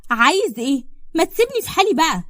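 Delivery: high, loud desperate outburst; exasperated protest. Acted. Ferdous, held roughly by Salem, demands freedom in loud exasperation. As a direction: cry out to be left alone.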